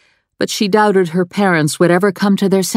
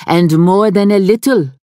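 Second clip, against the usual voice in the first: higher, louder blunt French seamstress; 5 semitones higher, 4 dB louder than usual. A narrator's reading. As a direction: scold the client like a haughty French dressmaker. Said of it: "the seamstress said".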